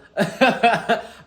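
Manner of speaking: loud, high laugh at herself; giggling burst; burst of laughter. Real, not acted. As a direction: laugh out loud before starting. Laughing at own intro.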